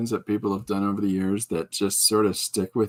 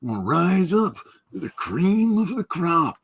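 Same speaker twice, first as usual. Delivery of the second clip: slow, stretched-out showman promo, pitched up; wrestler promo bit; 6 semitones higher, slower than usual. Retold or imitated. Man squeezing the creamer into his stage talking bit, drawn-out promo voice. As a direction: draw it out like a hyped stage character. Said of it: "his little talkie bit".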